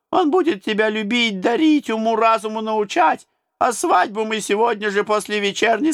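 high, teasing tsar voice; mocking tsar. A narrator's reading. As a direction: play the tsar savouring a cruel joke.

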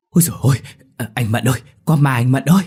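high, loud frightened wail; terrified. A narrator's reading. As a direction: tremble in panic. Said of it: "mà run run".

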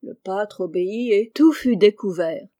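ordinary delivery, nothing marked

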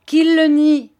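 loud, high challenge; defiant shout. Acted. Pupil hurling a challenge at the headmaster, shouting. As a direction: fling the words out.

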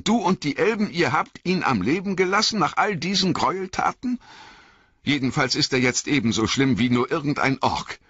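indignant Frodo. A narrator's reading. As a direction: protest hotly against mercy. Frodo protests angrily.

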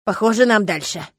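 ordinary delivery

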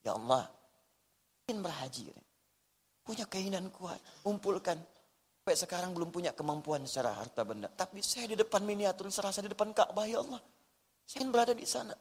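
softly retold weeping prayer; hushed pleading, tears only hinted; tearful plea. Pilgrim praying before miniature Ka'bah, softly imitated tearful plea. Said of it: "dia menangis".

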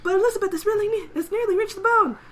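high, excited play-by-play; race announcer. Acted. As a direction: call the near finish with urgency. Acting the announcer's race call.

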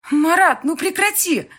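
high, loud plea to stop; calling him. A narrator's reading. Bride calling groom out of the flowerbed, pleading. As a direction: call out in exasperation, pitch way up. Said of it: "позвала я его".

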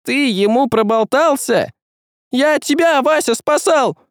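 very high squeal of a little creature; squealing hohlik. A narrator's reading. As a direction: shriek it defensively. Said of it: "взвизгнул хохлик".

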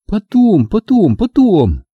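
very high, drawn-out repeated refusal; Angela answering coldly. A narrator's reading. Angela putting off his advances, high dismissive voice. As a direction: brush him off in a high voice. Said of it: "холодно ответила Анжела".

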